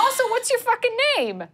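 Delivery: high-pitched, indignant demand; combative demand. Acted. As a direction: escalate the squabble, voice shooting up. Acted quarrel between characters.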